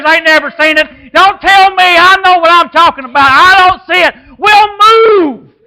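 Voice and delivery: loud, soaring preacher's shout; full-force preaching. Acted. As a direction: preach it, lifting the crowd.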